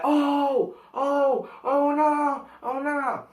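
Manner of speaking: high, hammed-up "oh no" chant; mock classroom uproar. Classmates teasing Ray after his rude line, high mock alarm. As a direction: squeal it like classmates egging on a fight. Classroom reacting to Ray's words.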